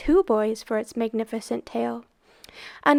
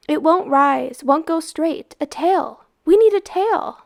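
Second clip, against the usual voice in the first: rising to an excited shout; urgent, building to a cry for a tail; urgent call; 5 semitones higher, 6 dB louder than usual. Acted. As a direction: boys calling out in alarm, then shouting. Boys panic as kite falters.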